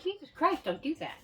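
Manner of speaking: hammed-up startled husband; exasperated husband. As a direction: scold her for the scare. Husband scolding after the scare.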